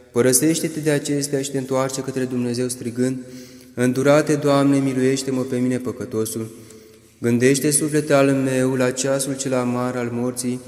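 normal talking voice

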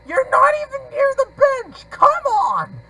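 very high, loud outburst at the game; exasperated yell. Real, not acted. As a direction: yell it in sheer frustration.

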